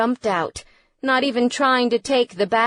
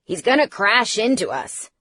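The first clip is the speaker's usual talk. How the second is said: alarmed, raised voice; mild narrated shout; 4 dB louder than usual. A narrator's reading. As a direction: call out a warning. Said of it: "Tristan shouted".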